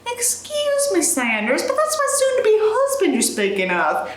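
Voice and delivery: high, indignant British lady; offended Josie. Acted. Reader voicing Josie defending her fiancé, indignant. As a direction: clutch the pearls and correct him.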